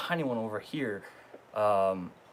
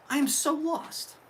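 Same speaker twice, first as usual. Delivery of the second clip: baffled; 13 semitones higher than usual. Real, not acted. Confused by the mixed-up gift note.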